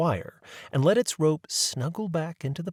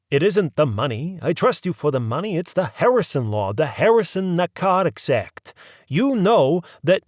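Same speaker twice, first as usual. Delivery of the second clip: firm druggist voice; 6 semitones higher, 6 dB louder than usual. A narrator's reading. Druggist explaining firmly.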